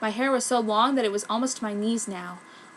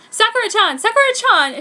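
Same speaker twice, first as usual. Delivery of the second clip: much higher, louder frantic calls; screaming; 12 semitones higher, 10 dB louder than usual. Acted. Haruko running in, screaming for Sakura. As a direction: scream her name across the hall. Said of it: "came screaming".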